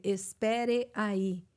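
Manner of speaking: "Espere aí" drawn out syllable by syllable; slow, over-careful. Shown as a demonstration. Teacher imitating lessons for an American, slow=. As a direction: stiff textbook "Espere aí". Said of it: "ele aprender".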